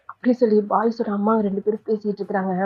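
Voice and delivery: usual speaking voice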